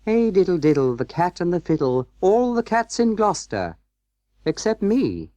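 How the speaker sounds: high-pitched storybook cat voice; Simpkin the cat. A narrator's reading. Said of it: "said Simpkin".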